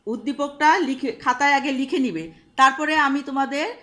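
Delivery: loud, carrying classroom voice; loud instructions. Real, not acted. Teacher gives the class homework, loud classroom voice. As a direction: call it out so the whole class hears.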